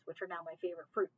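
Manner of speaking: plain reading voice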